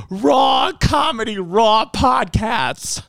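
slow, high hype shout; drawn-out hype cry. Acted. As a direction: call it out like an announcer. Hyping the show.

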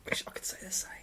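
wry whispered confession. Real, not acted. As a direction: whisper it ruefully aside. Whispered rueful aside.

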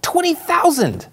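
"Twenty thousand" said high and slowly, stretched out; emphatic alarm. Acted. Host hammers home the crowd size to listeners, emphatic alarm. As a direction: let "Twenty thousand" soar up, slow.

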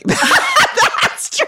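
high, laughing squeal of a reply; laughing agreement. Retold or imitated. Host retelling her husband's Roy Kent jab, laughing high. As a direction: laugh it out, delighted. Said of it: "I was like".

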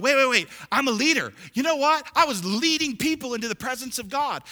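loud, high preacher voicing sudden realisation; shouted, high-pitched testimony; excited recollection. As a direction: burst out with it, urgent and proud.

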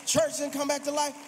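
loud, high, driving preacher's climax; preaching at full force. Acted. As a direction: build the congregation up, loud and fervent.

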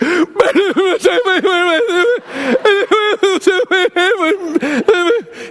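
a breathless gasp that breaks into a half laugh, half sob, pitched high. Retold or imitated. Stranded in the snow at night, greeting the mechanic with a gasping sob. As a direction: let out a shaky gasp of relief that tips into a sob. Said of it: "And I'm just like".

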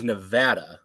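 Nevada with the a of cat, nuh-VAD-uh; correct local pronunciation. Shown as a demonstration. Co-host shows what Veep taught, Nevada as nuh-VAD-uh. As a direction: stress the middle of Nevada, VAD. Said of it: "I do know, thanks to Veep".

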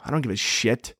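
ordinary delivery

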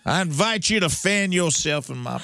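put-on high-born Southern accent. Inviting a guest into the parlor, Southern accent. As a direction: play the Southern parlor hostess. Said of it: "the high-born Southern accent".

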